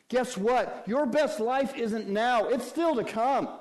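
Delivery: high, loud, ringing proclamation; full-force preaching. Acted. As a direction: shout the hope to the room.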